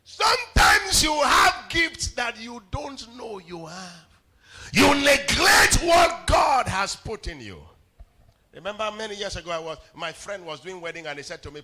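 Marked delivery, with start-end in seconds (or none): 0.0-7.6 slow, loud, much higher preaching; preaching at full force. Acted. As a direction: drive the point home slowly. Preaching climax.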